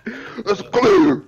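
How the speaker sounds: high, loud eyeball-popping shout; extremely forceful. Shown as a demonstration. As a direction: demonstrate an over-forceful SWAT yell. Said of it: "say it with so much force".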